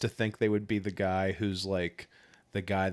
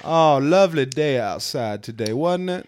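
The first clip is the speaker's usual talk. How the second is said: lightly voiced genteel southern lady; southern belle; 6 semitones higher than usual. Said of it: "I like your seersucker or blazer".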